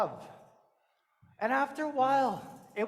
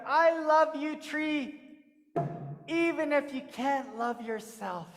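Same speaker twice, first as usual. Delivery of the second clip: a touch of tender pleading; mean every word of it, gently; tender declaration; 6 semitones higher, 5 dB louder, slower than usual. Acted. Coyote tells the tree he loves it, tenderly.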